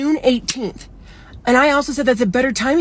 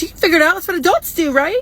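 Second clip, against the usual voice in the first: higher, patronizing retort with a rising "right?"; mock condescension; 7 semitones higher than usual. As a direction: imitate someone talking down to you in a high voice. Roommate's patronizing retort, retold.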